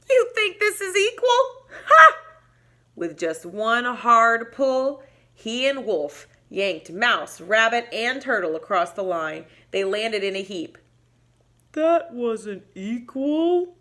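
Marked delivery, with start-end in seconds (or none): 0.1-2.2 high, loud scornful laugh; mocking laugh. Bobcat mocking the teams with a loud laugh. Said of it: "Bobcat laughed". 11.7-13.8 slow, plodding turtle voice; a hint of a sleepy old turtle. Box Turtle slowly asking what equal means. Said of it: "Turtle complained".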